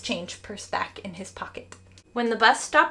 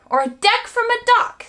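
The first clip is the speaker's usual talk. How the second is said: much higher, loud scream; 13 semitones higher, 7 dB louder than usual. A narrator's reading. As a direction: top him with a shriek. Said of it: "screamed Stuart".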